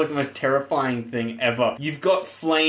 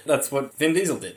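ordinary talk

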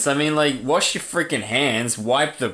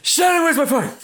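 high-pitched sneezed-out hollering; mock outburst; 8 semitones higher than usual. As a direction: holler it urgently, in comic bursts. Acts out friend's sneezed outbursts.

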